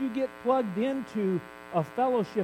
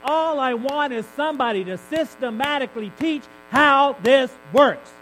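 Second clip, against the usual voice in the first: louder, higher exasperated demand; frustrated outburst; 3 semitones higher, 8 dB louder than usual. Real, not acted. Preacher venting frustration about learning prophecy, loud. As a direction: really let the frustration out. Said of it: "that's the teacher gift in me".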